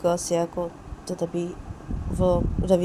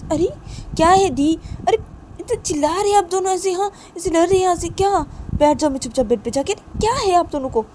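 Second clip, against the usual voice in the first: put-on high, raised girlish voice in protest; Rani, indignant; 11 semitones higher, 5 dB louder than usual. Rani protests at being told to sit down, indignant. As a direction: argue back at top pitch.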